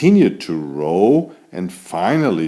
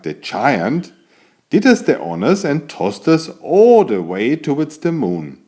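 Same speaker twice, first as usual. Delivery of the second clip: ordinary delivery, nothing marked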